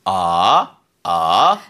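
"아" rising from low up to mid; Mandarin second tone. Shown as a demonstration. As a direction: slide "아" upward like a question. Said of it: "이성은 궁금해서".

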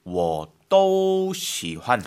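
very slow, each syllable drawn out; very slow repeat. Shown as a demonstration. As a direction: drag each syllable for the learner.

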